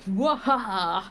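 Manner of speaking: hammed-up victory laugh; triumphant cackle. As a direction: crow over the win like a cartoon villain. Triumphant villain-style victory laugh.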